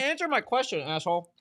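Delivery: teasing demand. Real, not acted. Teasing demand to his friend.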